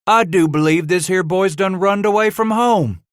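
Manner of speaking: high, loud amazed backwoods voice. Acted. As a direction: marvel aloud at the runaway boy. Boatman marvels at runaway boy.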